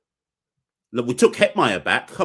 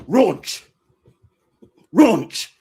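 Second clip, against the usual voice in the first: playful sprint call, louder; mock shout; 5 semitones higher, 6 dB louder than usual. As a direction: bark it out like a spin coach. Mock spin class sprint shout.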